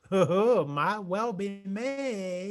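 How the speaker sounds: slow, coy, teasing drawl; a coy, slowed-down tease; coquettish. Retold or imitated. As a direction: flirt it out slowly, playing coy. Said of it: "Yeah, coquettish".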